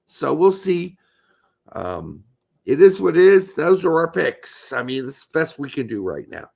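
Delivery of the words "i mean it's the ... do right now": ordinary delivery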